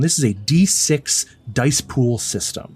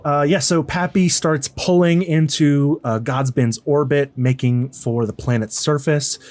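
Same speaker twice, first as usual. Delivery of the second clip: normal talking voice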